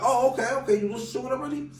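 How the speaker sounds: high, excited surprise; startled happy reply; surprised. Retold or imitated. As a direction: caught off guard, pitch jumping up. Retold surprised reply to Ghost.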